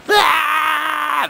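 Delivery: high mock child shriek; kids screaming. Teacher imitating kids running wild in class, high shriek. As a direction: shriek like a wild five-year-old.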